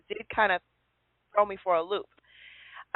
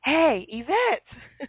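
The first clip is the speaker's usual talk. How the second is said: drawn-out call; 5 semitones higher, much slower than usual. Retold or imitated. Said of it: "I hear someone say".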